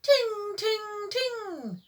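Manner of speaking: very high, sung ding ding. A narrator's reading. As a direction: chime it like a tiny bell. The mystery bell sound.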